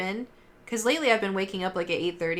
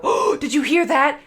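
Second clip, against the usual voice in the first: hammed-up fright, higher and louder; mock spooked gasp; 7 semitones higher, 6 dB louder than usual. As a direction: play-act hearing a ghost. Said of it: "she'd go".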